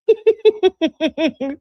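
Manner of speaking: high, loud burst of giggles; high laugh. Real, not acted. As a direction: break into high-pitched laughter. Cracks up at the skipped part.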